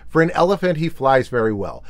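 plain reading voice

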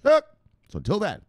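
high, pumped sign-off with a fast plug; hyped outro. Acted. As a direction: sell the channel like a showman closing the show.

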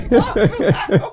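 Boswell! blurted out loud through laughter; loud laughing repeat of Boswell; laughing mockery. Real, not acted. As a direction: laugh it out, mocking the word Boswell. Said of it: "you said Boswell".

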